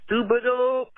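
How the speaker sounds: high, shouted super dope; catchphrase shout. Real, not acted. Caller signing off by shouting the catchphrase. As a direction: belt the show's name out. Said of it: "I'm gonna just say".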